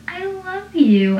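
put-on high, slow, teary drunk voice; drunk declaration. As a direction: slur it out all weepy and high. Drunk Joey declares love.

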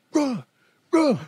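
high-pitched "bruh", acting upset; mock upset. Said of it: "like he's upset".

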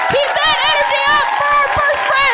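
high, loud emcee build; announcer hype. Real, not acted. Host bringing up the next comedian, loud high announcer hype. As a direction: pump up the crowd for the next act. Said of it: "host introduces next comic".

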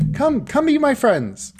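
high, loud beckoning call; calling over. Acted. Robin waves the doctor over to the car, high loud call.

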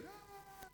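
high, breathy imitated "ah" of a worshipper; mock worship sigh. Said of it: "when Christians raise their hand, they go".

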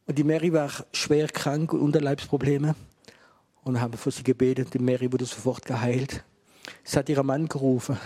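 ordinary talk

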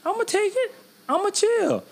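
high mock voice. Playing The Rock, high voice.

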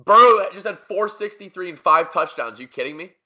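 ordinary talk

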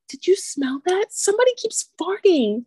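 lightly hammed prissy girls; mocking girls. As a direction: imitate the annoying girls complaining. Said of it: "annoying ass girls were like".

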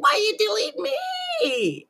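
high, slow, crying whine; whiny wail. Pretend. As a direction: wail it like a hurt child. Mock whining at being deleted.

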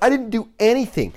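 high-pitched mock protest of innocence; indignant protest. Wrongly blamed brother answers his yelling dad, high mock protest. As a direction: plead innocence like a wronged kid.

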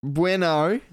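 BUENO pitched high and loud, rising at the end; exaggerated bueno. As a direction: play up the word, lifting it high. Repeats the word back two ways.

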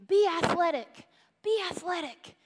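loud, high mock coach yell; imitating yelling coach. Sister's coach yelling at the girls, imitated coach shout. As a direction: bark it like a sideline coach. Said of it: "he'd yell at the girls".

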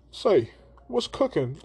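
lightly hammed-up character quote; playful character voice. Character voiced in retold story.

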